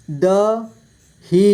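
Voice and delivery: दही split slowly into द, ही; syllable by syllable. Shown as a demonstration. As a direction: stretch and separate द and ही in दही. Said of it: "इस तरह से आपको". (दही को तोड़कर धीरे: द, ही)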